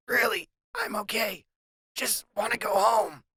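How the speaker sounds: high thin croaky voice after choking. Acted. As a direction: squeeze the words through a sore throat. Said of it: "talk in the croaky voice".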